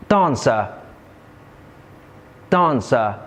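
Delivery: "Costume", "Dancer", "Clever", "Patient", each stressed on its first syllable. Shown as a demonstration. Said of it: "usually placed on the first syllable".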